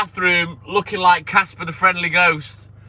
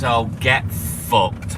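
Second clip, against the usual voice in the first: dismissive; 7 semitones lower, much slower than usual. Retold or imitated. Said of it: "all you'll hear from me is".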